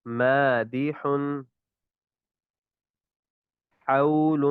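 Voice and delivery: each haa breathed out freely; correct haa. Shown as a demonstration. Teacher models the haa word list. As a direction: recite the haa words slowly and openly. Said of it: "اسی طرح یہ ہا کی بھی ادائیگی".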